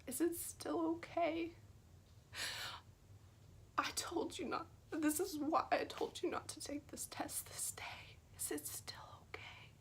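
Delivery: a tender whispered question; gentle whisper. Retold or imitated. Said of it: "Jesus saying".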